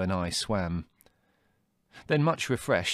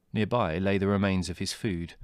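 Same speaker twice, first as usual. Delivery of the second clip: plain reading voice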